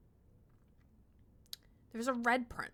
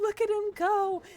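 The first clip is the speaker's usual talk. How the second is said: higher, louder cheer; raised pet voice; high coo; 10 semitones higher, 6 dB louder than usual. Real, not acted. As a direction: cheer the little runner. Cheering on the hamster.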